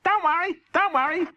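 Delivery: very high chirpy rabbit voice; Chris Rabbit. Acted. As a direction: reassure him brightly, up high. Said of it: "said Chris".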